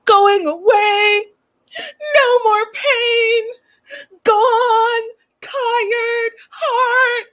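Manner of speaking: high, drawn-out fake crying; hammed-up weeping, very slow and high; Darcy. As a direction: wail disjointed words one at a time through mock sobs. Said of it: "So she goes like this".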